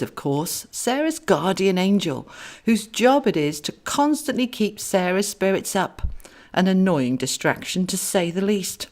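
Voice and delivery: plain reading voice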